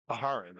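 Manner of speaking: "a Haran" with a light extra syllable before Haran; "a Haran" low-pitched, as a-HAR-an; corrected name pronunciation. Real, not acted. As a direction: say "a Haran" in three syllables, stressing the middle. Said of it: "I have been corrected".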